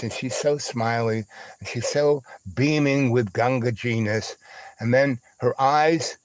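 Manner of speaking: usual speaking voice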